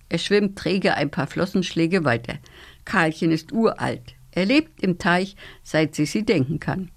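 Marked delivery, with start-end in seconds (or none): none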